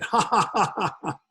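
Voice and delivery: high, mocking ha ha from above; booming heavenly laugh. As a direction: laugh down at the man like a god amused. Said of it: "a voice came from the heavens. It said".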